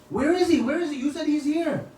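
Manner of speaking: eager demand. Retold or imitated. Devotees demand of the king where Nilmadhav is, urgent high questions. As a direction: press them, desperate to see him.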